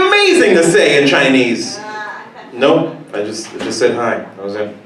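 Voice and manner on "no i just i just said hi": ordinary talk, nothing marked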